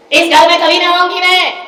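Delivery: loud, high refusal; loud, defiant daughter; loud vow. Retold or imitated. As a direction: swear never to return. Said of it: "अरे बोले".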